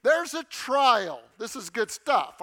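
high, slow, loud gushing at a trial; overjoyed believer, played up; mock delight. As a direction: ham up cheerful delight over a trial. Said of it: "I'm not there yet".

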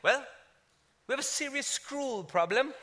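British-sounding, with squirrel as "scroll"; imitation British accent. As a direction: put on British politeness, rising at the end. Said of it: "you wanna talk like them".

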